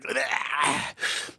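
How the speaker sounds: soft, voiceless mock gag; disgusted bleh. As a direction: imitate a drinker retching in disgust. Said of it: "he always makes a face like".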